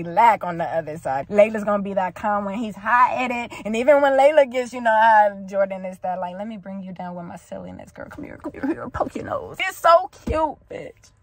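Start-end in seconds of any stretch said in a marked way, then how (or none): 6.4-9.6 soft, hushed teasing of a lover, imitated; playful comforting. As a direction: tease gently in a near whisper. Voices partner cheering her up.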